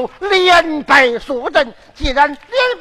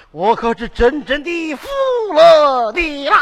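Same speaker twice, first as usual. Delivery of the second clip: 哭腔叹服. Acted. 父王哭笑叹服.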